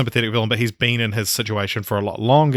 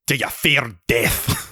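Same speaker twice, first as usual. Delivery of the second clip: slow, menacing Scottish Davy Jones; Bill Nighy's Scottish take on a Dutch captain; Scottish accent; slower than usual. Retold or imitated. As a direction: growl it out in a Scottish accent like a cursed sea captain. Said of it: "but I can do Scottish".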